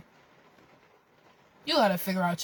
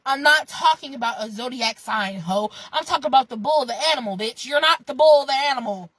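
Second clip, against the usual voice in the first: ordinary delivery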